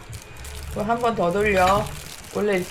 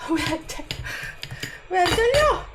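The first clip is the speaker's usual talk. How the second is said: frustrated; 10 semitones higher than usual. Real, not acted. Frustrated, peel won't cut.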